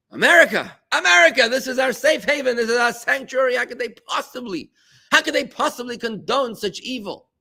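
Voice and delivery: high, loud plea poured out over the congregation; impassioned preaching. Acted. Rabbi cries out to the congregation about America, preaching at full force. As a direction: cry it out like a preacher in anguish. Leads a sermon on fear.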